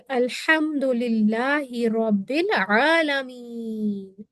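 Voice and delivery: الحمدللہ رب العالمین recited slowly, in a chanted style; slow recitation. Shown as a demonstration. Teacher recites the verse slowly for students. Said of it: "عین سے word ہے".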